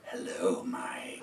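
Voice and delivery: low breathy come-on; hushed teasing caller voice; sultry low voice. Retold or imitated. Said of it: "and went".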